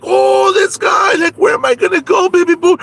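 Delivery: very high, loud frantic plea; shrill protest. Acted. Conchita pleading where she will go, shrill and loud. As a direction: wail back in disbelief.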